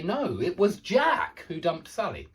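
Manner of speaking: "Jack" punched out above the other words; stress on Jack. Shown as a demonstration. Teacher showing where emphasis goes, strong stress on "Jack". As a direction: put firm emphasis on "Jack". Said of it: "you put emphasis on that part".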